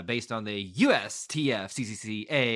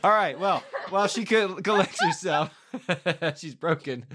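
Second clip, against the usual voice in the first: talking through laughter; giggling while trying to speak; laughing; 8 semitones higher, 7 dB louder than usual. Real, not acted. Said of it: "Stop laughing at me".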